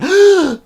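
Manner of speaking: sharp high loud gasp. Acted. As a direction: gasp as if falling. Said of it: "a gasp as he".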